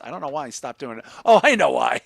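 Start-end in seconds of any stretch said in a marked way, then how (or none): 1.2-2.0 loud, knowing exclamation; loud realisation. Real, not acted. Host joking about why a voice stopped, loud exclamation. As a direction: burst out with sudden realisation. Knowing joke, repeated under breath.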